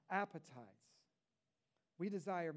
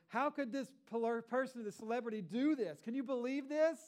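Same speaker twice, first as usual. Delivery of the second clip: mock outraged headline; 6 semitones higher, 6 dB louder than usual. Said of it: "the next headline is".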